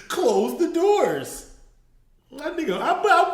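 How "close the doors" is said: louder, slow drawn-out order laughing; mock preacher order. Mocking the pastor's order, loud and slow. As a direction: belt it out slowly through laughter. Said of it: "quoting the pastor again".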